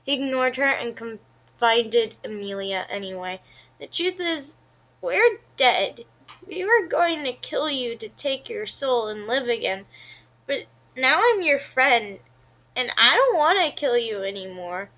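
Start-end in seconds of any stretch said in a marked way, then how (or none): 3.8-14.9 brave confession. A narrator's reading. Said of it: "Caleb said bravely".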